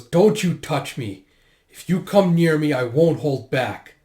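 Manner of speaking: slow, loud warning; furious. Acted. Furious Yona warns off the soldier, angry threat. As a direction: threaten them, drawing it out with force. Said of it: "Yona just gets furious".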